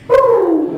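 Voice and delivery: high, loud hooting bird call. Acted. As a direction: hoot a sharp signal across the club. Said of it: "we use the bird call".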